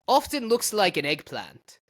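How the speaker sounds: light mock German accent. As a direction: read it like a German doctor. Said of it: "this needs to be read in a German accent".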